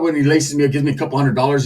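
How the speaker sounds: ordinary talk, nothing marked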